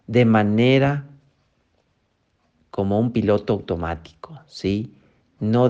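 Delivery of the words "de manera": normal talking voice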